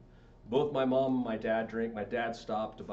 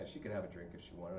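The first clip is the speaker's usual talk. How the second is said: ordinary talk, nothing marked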